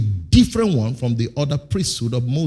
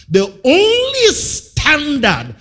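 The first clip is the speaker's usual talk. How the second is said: loud, high, slow proclamation; emphatic preaching; 12 semitones higher, 7 dB louder, much slower than usual. Acted. Preacher declaring to the church, slow and loud. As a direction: drive each word home.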